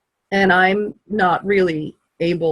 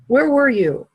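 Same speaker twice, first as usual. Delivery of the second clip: sharp, higher angry question; angry demand; 6 semitones higher than usual. Retold or imitated. Daughter confronting her dad, an angry higher question. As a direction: demand to know where he was, mad. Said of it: "instantly I got mad again".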